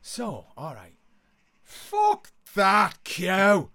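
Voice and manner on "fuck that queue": very loud, slow bellow, each word held; rallying cry. Retold or imitated. Ringleader rousing lads at the ferry queue, bellowed cry. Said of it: "the cry went up from the".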